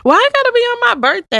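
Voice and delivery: high-pitched, quick protest; indignant protest. Real, not acted. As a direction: object in a high, rushed voice. Protests at the birthday hypothetical.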